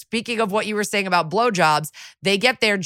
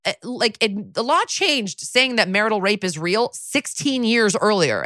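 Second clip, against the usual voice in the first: normal talking voice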